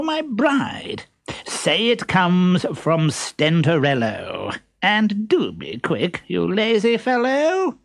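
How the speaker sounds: very high, pompous suitor voice; silly pompous voice. Acted. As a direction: play a vain fool, high and squeaky. Stentorello from the trunk.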